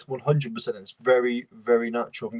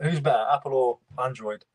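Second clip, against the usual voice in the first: ordinary talk, nothing marked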